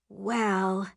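drawn-out, hesitant mother. A narrator's reading. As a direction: hesitate before committing. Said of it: "my mom hesitated".

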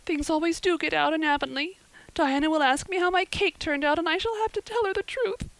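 put-on high, wailing, distraught girl's voice; sobbing girl. A narrator's reading. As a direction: pour out the misery in a high acted wail. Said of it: "sobbed Anne".

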